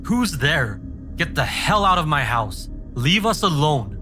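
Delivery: high, furious scream; screaming in rage. Acted. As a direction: scream at the empty room in rage. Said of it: "I turned and screamed".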